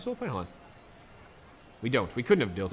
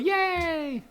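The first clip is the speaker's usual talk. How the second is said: mock yay. Real, not acted. Cheering mock.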